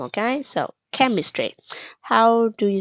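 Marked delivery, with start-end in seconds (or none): none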